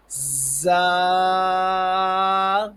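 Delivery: زا with a hard whistled buzz, then long vowel; firm whistling za. Shown as a demonstration. Teacher shows a whistle letter, hard drawn-out زا. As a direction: press زا out firmly and hold it loud. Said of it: "سیٹی کی تھوڑی سی آواز بھی نکلیں اور سختی سے".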